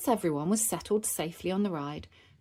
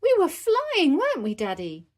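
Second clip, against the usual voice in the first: high, loud burst, settling at the end; high-pitched breakout of joy; thrilled child; 10 semitones higher, 6 dB louder than usual. Acted. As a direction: blurt it to Daddy with glee. Peppa exulting after the ride.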